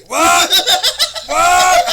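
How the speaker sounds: normal talking voice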